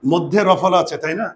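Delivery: normal talking voice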